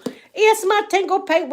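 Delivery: high, loud delighted hail; excited calling out. Real, not acted. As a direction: call out the name, pitched right up. Calls out to arriving viewer.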